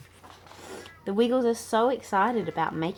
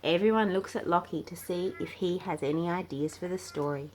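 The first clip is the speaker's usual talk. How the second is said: ordinary delivery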